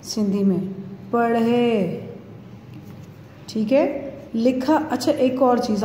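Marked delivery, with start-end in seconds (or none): 1.1-2.1 पढ़े with the final e held; long e ending. Shown as a demonstration. Teacher modelling the Sindhi word, पढ़े with a long e ending. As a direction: stretch the badi ye at the end of पढ़े. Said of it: "इसको पढ़ेंगे पढ़े".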